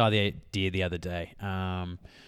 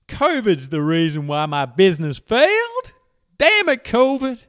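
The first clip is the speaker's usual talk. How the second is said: high, loud whiny excuse-maker impression; hammed-up outrage, getting higher on the curse; mock blaming complaint; 9 semitones higher, 7 dB louder than usual. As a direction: play someone blaming everything but themselves. Mimicking people blaming covid for failure.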